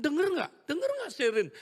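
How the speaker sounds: high, loud eager urging; excited mother. Retold or imitated. Mother urging Sherin to listen, in an excited high voice. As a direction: press the child with joyful urgency. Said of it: "dia bilang".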